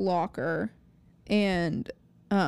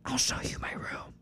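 whisper yelling; 8 dB quieter than usual. Retold or imitated. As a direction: whisper it but push it hard. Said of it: "she whisper yells".

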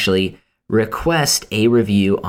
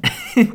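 laughing. Real, not acted. Laughs mid sentence.

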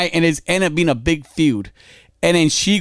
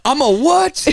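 high-pitched incredulous comeback; mock outraged disbelief; 9 semitones higher, slower than usual. Partner reacting to being called a name, mock outraged disbelief. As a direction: replay an offended double take, pitched way up.

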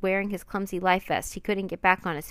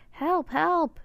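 much higher, louder panicked scream; screaming for help; 9 semitones higher, 5 dB louder than usual. Acted. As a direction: scream while drowning in the waves. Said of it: "he screamed".

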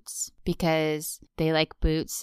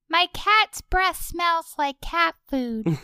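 Ralph Wiggum voice; 10 semitones higher, slower than usual. Retold or imitated. Ralph impressions trade.

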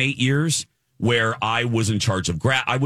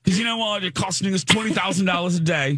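higher, lightly British, matter-of-fact; dismissive British accent; 10 semitones higher than usual. Retold or imitated. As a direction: state the cost like a curt Brit. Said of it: "he was so dismissive".

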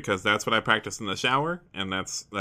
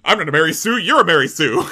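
higher and louder, a playground taunt; childish comeback; 5 semitones higher, 9 dB louder than usual. Acted. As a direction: fire it back like a kid in a spat. Childish retort between characters.

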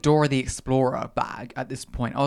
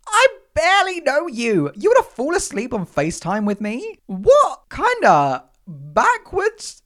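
high, loud, stretched-out mock outrage; hammed-up incredulous rant, word by word; shocked disbelief; 8 semitones higher, 4 dB louder, slower than usual. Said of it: "instantly I get whiplash".

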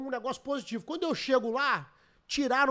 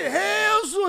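excited greeting; 11 semitones higher, 10 dB louder than usual. Retold or imitated.

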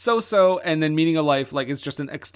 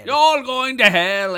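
hammed-up scolding mother; 7 semitones higher, 6 dB louder, slower than usual. Echoes the mother's line.

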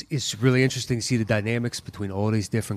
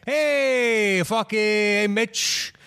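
hammed-up New York accent, pitched up and drawn out slowly; a New York tough guy ribbing Mitch; mock New York accent; 8 semitones higher, much slower than usual. Ribbing Mitch from T D Ameritrade in mock New York accent. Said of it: "Now it's New York".

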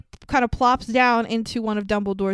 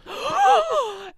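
high, sharp theatrical gasp; dramatic gasp. Acted. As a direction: gasp in mock shock. Dramatic courtroom reveal.

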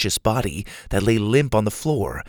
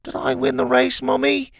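put-on very high little-child question; dazed childlike voice; 14 semitones higher than usual. Concussed Doctor Rex takes Helga for his mother, childlike. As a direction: ask innocently in a high, dazed voice.

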